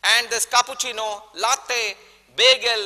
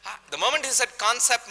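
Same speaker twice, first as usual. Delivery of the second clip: ordinary talk, nothing marked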